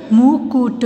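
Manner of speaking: मुकुट slow, in separate syllables. Shown as a demonstration. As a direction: sound out मुकुट. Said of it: "फिर ये सारे बोलने". (मुकुट को तोड़-तोड़ कर)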